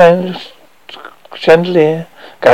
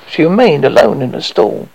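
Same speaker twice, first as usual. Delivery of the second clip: usual speaking voice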